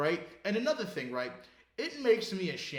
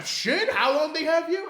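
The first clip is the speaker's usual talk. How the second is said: mock astonished; 10 semitones higher, 8 dB louder than usual. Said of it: "You know, I'm".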